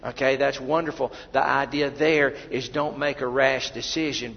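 ordinary talk

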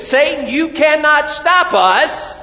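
loud, slow, high defiance at Satan; defiant shout. Acted. Preacher defies Satan for the church, loud and slow. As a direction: shout it down at the devil. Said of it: "move with the attitude of".

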